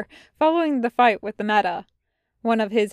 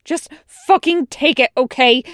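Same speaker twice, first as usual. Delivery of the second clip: loud, raised-pitch angry order; angry snap; 4 semitones higher, 9 dB louder than usual. A narrator's reading. Wash throws down his bag at Tucker, snapping loudly. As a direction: snap it out louder. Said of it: "Wash snaps louder".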